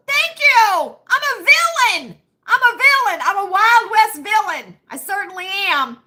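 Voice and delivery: high, loud Wild West villain bravado; hammed-up villain. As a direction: ham it up like a cowboy villain. Hamming it up as villain.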